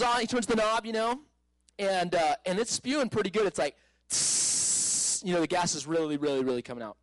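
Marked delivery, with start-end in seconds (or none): 4.1-5.2 long, sustained hissing tss; drawn-out hiss through the teeth; imitated gas hiss. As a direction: imitate gas pouring out of a valve.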